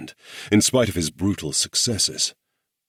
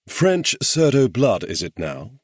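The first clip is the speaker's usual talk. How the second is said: higher, louder, arched and sceptical; raised-brow challenge; 6 semitones higher, 4 dB louder than usual. A narrator's reading. Morgan challenges their reluctant ally, sceptical. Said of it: "Morgan raised a brow".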